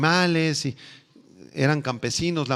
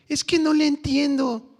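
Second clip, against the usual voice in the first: whiny youth; 13 semitones higher than usual. Retold or imitated. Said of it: "me dijo un día un jovencito".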